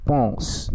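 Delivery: "পন্স" said as pons, nasal vowel, silent final e. Shown as a demonstration. Teacher shows how "পন্স" is pronounced. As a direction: give "পন্স" its French nasal o sound. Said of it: "উচ্চারণের ক্ষেত্রে আমরা যেটা দেখতে পাচ্ছি".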